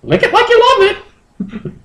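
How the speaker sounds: very high falsetto, breaking into a laugh; squeaky creature voice, giggling at the end; high Moltres voice. Acted. Moltres instructing Justin, high and giggly.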